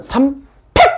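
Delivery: 팩 popped out high and abrupt; 삼, then a sudden high 팩; punchy short reading. Shown as a demonstration. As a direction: punch 팩 as one short burst. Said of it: "이렇게 읽을게".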